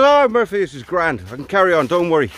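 high, carefree mock Irish voice; terrible Irish accent. Paddy reassuring Murphy mid-fall, hammed-up Irish accent. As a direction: brush it off in a bad Irish brogue. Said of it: "apologies for the terrible accent".

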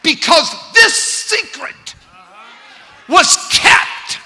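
high, loud preaching shout; full-force preaching. Real, not acted. Preacher driving the point home, high loud shouting. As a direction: preach it at the top of the lungs.

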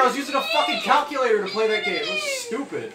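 loud, fast, high-pitched rant; shouting rant. Real, not acted. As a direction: yell it in disbelief. Ranting about calculator controls.

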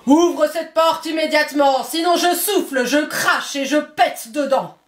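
the wolf shouting at the pig's door; big bad wolf. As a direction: bark it out like the hungry wolf at the door.

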